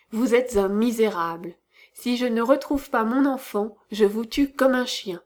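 usual speaking voice

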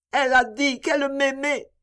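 put-on high, mournful child's voice; a little girl clinging to a memory; grieving little girl.

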